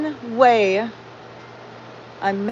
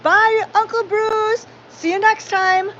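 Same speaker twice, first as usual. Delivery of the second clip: much higher, slow, lilting farewell; sing-song goodbye; 10 semitones higher than usual. Retold or imitated. How the family always saw Uncle Bruce off, sing-song and high. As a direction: chime a cheery family goodbye. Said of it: "We always said that".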